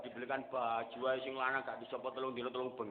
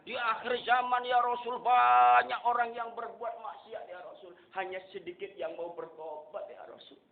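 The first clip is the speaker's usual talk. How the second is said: loud, raised sermon cry; fervent preaching; 9 semitones higher, 11 dB louder than usual. Acted. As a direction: proclaim each line to the crowd. Preaching at full force. (khotbah lantang berapi-api)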